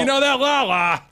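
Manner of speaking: mock Teletubby voice. Mocking Teletubby voice.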